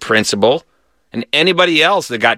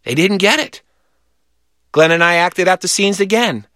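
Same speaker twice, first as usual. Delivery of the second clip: ordinary delivery, nothing marked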